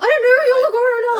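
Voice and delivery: high, loud mock whine; exasperated daughter. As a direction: whine it at useless parents, hammed-up. Voicing exasperated sister at parents.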